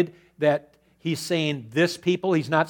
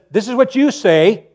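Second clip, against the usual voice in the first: loud, higher rebuke; 6 semitones higher, 9 dB louder than usual. Acted. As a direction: throw their words back at them. Mocking quote of excuses.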